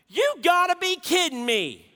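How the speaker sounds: exasperated cry. Retold or imitated. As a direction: cry it out in disgusted disbelief. Daughter repeats in disbelief.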